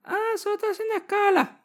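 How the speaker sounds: very high, squeaky, jittery voice; nervous squeaky self. Retold or imitated. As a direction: squeak it out like a scared traveler. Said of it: "pero me pongo nervioso".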